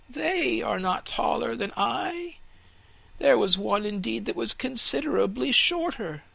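put-on a young tree asking anxiously; worried young fir tree; curious fir tree. Fir questions the cut trees.

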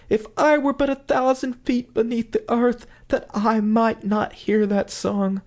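high, desperate pleading, slowing at the end; anguished pleading. Acted. Wife wishing herself beneath the earth, desperate pleading. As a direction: beg to be spared the song. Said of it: "cried the wife".

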